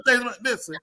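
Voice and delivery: ordinary delivery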